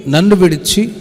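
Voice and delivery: usual speaking voice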